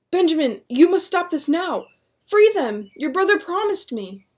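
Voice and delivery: high loud pleading demands; desperate demand. Acted. William demanding the magistrate free his family, desperate loud plea.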